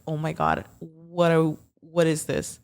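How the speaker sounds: ordinary delivery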